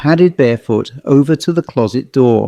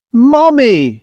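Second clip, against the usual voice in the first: high, drawn-out scream for help; 11 semitones higher, 3 dB louder than usual. A narrator's reading. Miss Harper flees the doll, screaming high. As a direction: scream it out high, like a frightened child. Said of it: "she screamed".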